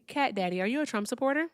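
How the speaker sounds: usual speaking voice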